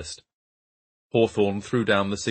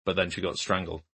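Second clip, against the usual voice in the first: flat. Acted. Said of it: "Hawthorne said flatly".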